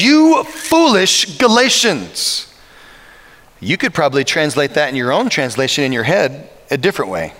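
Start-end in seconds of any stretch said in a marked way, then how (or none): 0.0-2.4 slow, stern scolding; a stern father's slow rebuke; stern Paul rebuke. Retold or imitated. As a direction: drag it out like a disappointed apostle. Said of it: "Very stern".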